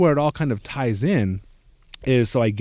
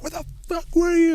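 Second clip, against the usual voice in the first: much higher, retold furious demand; imitating angry hosts the morning after; mock angry friends. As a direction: play the mad friends, lightly hammed. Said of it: "they were obviously p-, so mad".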